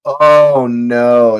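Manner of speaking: a loud groaning oh no; loud dismay. Real, not acted. As the keeper's mistake sinks in, loud dismayed groan. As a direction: cry out in dismay. Reacts to goalkeeper disaster.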